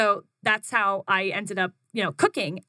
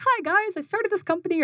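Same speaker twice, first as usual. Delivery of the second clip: much higher, upbeat social-media greeting; bubbly announcement; 11 semitones higher than usual. Retold or imitated. Posting on Facebook to friends, a bubbly announcement. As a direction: greet followers brightly. Reading her Facebook post.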